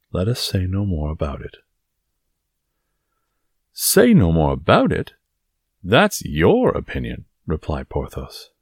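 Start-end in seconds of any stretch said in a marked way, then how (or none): 0.1-1.6 even, composed, final; calm dismissal. Acted. Aramis drops the subject with Porthos, calmly dismissive. 3.7-7.2 incredulous, with a loud punch on SAY; indignant echo. Acted. Porthos challenges Aramis's dismissal, indignant.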